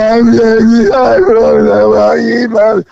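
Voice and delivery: high, slow, garbled mock wail; mock incoherent sobbing; imitated hysterical babble. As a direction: blubber unintelligibly, imitating a crying woman. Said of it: "I don't understand anything you're saying".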